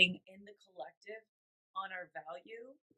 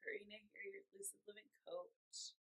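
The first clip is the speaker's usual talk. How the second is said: lost voice whisper; 17 dB quieter than usual. Real, not acted. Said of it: "I kinda lost my voice".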